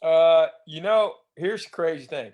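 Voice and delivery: ordinary talk, nothing marked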